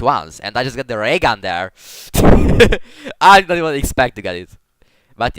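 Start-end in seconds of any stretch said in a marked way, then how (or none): 2.1-3.2 short high, loud burst of laughter; real laugh. Real, not acted. Player surprised at getting the ray gun, real laugh.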